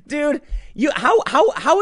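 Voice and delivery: high incredulous stammer; exasperated. Real, not acted. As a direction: sputter in disbelief.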